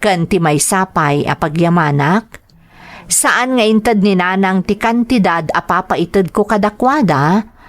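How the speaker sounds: ordinary talk, nothing marked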